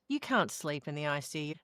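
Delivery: ordinary talk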